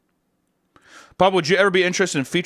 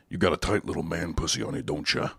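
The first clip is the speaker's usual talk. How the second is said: much lower and softer, a gruff put-on growl; put-on catchphrase voice; 11 semitones lower, 9 dB quieter than usual. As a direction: drop into a low creepy character voice. Reading his own catchphrase in a voice.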